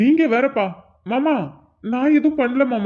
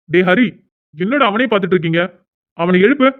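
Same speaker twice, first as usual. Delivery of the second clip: loud, lower-pitched urgent shouting; loud urgent call; 4 semitones lower, 5 dB louder than usual. Acted. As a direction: bark at them to wake him up. Urgent scene, waking unconscious friend.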